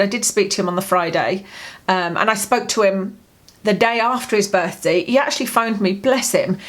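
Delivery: usual speaking voice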